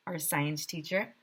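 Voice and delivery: ordinary delivery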